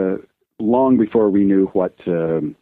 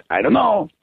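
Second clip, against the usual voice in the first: a much higher, lightly mock-helpless "I don't know"; Juan's shrug line; 11 semitones higher than usual. Telling a friend how Joe got laughs, in a high put-on voice. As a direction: toss it off like a comic character. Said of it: "make us laugh with just".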